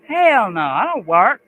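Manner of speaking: loud, high joking shout of refusal; mock yell. Streamer jokingly yelling at his friend. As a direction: bellow it as a fake protest. Mock outburst then "just kidding.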